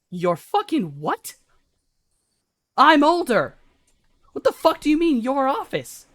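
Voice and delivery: incredulous, rising to a high shout; loud, high-voiced acted indignation; indignant outrage. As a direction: explode in disbelief at the insolence. Older brother challenged in quarrel.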